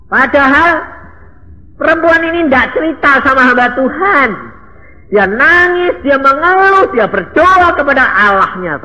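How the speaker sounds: high, loud fiery sermon; impassioned shouting preacher; preaching at full force. Real, not acted. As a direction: drive the point home to the congregation. Peak of sermon.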